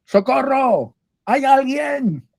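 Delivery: high, frantic call for help from a cliff edge; desperate high yell, hanging from a branch; panicked cry for help. Acted. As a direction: shout up from the precipice in panic. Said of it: "el hombre empieza a decir".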